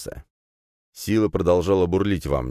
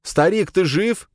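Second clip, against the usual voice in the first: high, slow alarmed cry; 4 dB louder, slower than usual. Acted. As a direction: call out to the old man in alarm. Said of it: "вскрикнул я".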